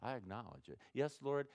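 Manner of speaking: soft, low, humble confession to the Lord; quiet humble prayer. Shown as a demonstration. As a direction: pray it softly, owning the sin. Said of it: "See, that's, that's confession".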